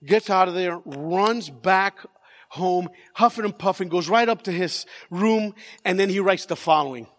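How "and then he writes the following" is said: ordinary talk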